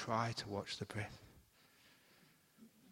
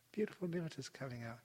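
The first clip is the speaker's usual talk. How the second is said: normal talking voice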